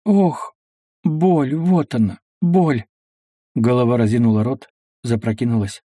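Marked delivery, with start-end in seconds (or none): none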